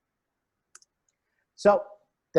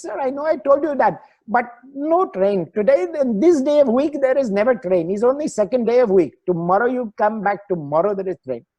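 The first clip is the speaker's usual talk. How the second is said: lightly imitated Indian English ticket clerk, higher; a hint of an Indian clerk's accent, insistent and a bit higher; 9 semitones higher, 3 dB louder than usual. Clerk explains no train.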